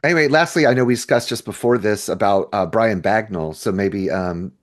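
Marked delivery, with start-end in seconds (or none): none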